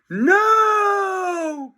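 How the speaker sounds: high shouted no; frantic high shout; cry. A narrator's reading. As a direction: yell to stop them. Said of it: "cried George".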